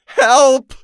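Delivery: high, loud desperate scream; piercing cry for help. A narrator's reading. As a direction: shriek for help with everything. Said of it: "the woman screamed".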